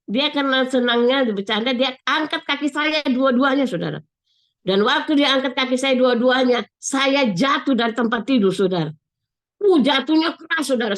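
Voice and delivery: usual speaking voice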